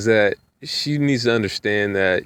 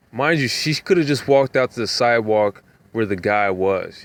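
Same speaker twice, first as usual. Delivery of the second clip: usual speaking voice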